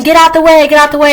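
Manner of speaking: high, loud retold yell of get out the way; loud imitated shouting; mock shout. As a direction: shout them out of your path, half-playful. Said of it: "you could be shouting".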